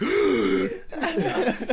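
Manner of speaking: high laughing gasp for air; mock gasp. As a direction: gasp like you can't breathe from laughing. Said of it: "gasping for air".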